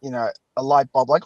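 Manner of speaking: normal talking voice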